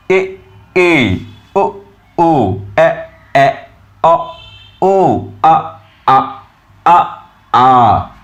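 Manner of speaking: short then long vowel pairs a, o, e; each vowel twice, first short then long; vowel demo. Shown as a demonstration. Teacher modelling monophones for students to copy. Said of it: "These are the correct pronunciation".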